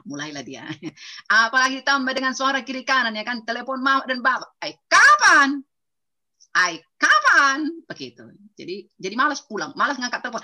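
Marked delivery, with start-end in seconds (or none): 4.6-7.7 slow, loud, high drawn-out nagging; nagging parents. Retold or imitated. Parents on the phone nagging their son about marriage, slow and loud. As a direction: stretch out the nag like a pestering mother.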